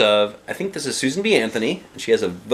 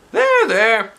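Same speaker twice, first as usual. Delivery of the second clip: high, louder mock soothing; 8 dB louder than usual. Doctor Nicholas patting Andrea on the back, soothing. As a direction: pat the words out.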